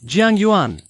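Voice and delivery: calling out. Acted. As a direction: call out to her, drawing the name out. पीछे से नाम पुकारता है. (ऊँचे सुर में पुकारते हुए)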